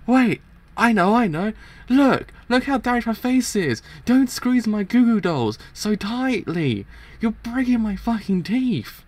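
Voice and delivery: high, loud squealing panic; acted high-pitched desperate begging; panicked pleading. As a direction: squeal it out as if being crushed. Character squeezed, pleading in pain.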